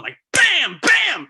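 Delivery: high loud "bam, bam" like roots hitting the boat; imitated bangs. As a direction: bang it out like debris striking metal. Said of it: "roots going".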